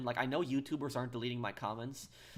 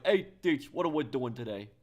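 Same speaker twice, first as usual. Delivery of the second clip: loud, put-on New York greeting; mock New York accent, punched loud at the start; 7 dB louder than usual. Shown as a demonstration. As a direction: call it out like a New Yorker. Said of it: "that's more New York slang".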